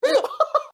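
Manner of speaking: shrieking laugh; high burst of laughter. Real, not acted. Streamer cracking up at her ghost joke. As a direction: crack up at your own joke.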